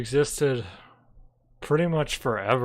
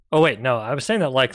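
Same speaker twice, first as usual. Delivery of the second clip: ordinary talk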